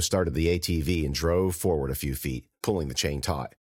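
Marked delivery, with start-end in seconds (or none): none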